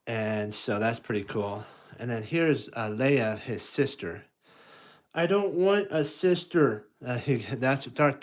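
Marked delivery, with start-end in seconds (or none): 5.1-6.9 put-on high, louder whiny kid; sulky little boy. Luke refusing a new sister, high sulky kid voice. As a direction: pout it like a jealous child.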